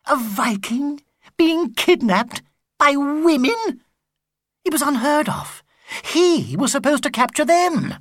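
wounded Viking pride; indignant Viking. Acted. Sigurd's indignant thoughts.

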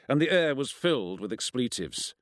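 ordinary delivery

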